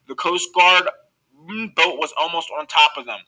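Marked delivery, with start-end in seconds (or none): none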